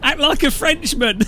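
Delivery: high, loud comic command; squawked mock-desperate instruction; retold frantic yelling. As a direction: shout urgent orders, hammed-up. Retold orders to panicking friend.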